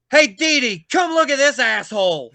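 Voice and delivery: loud, high shout down the hall; hollering into the locker room; calling out. Retold or imitated. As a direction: yell for someone to come see. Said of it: "and he goes".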